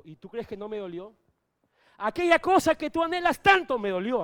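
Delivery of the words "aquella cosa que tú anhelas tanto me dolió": loud, higher cry of pain; pained outcry. Real, not acted. Pastor tells the congregation what it cost him, loud pained outcry. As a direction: belt it out from the hurt.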